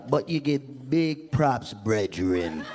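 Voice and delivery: low, slow Jamaican patois; laid-back imitation Jamaican; friendly Jamaican accent. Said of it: "But Jamaicans are so nice".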